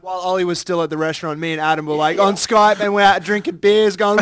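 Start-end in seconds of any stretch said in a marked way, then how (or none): none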